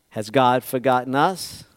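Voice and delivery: ordinary talk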